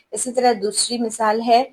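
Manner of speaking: normal talking voice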